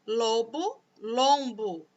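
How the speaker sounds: ordinary talk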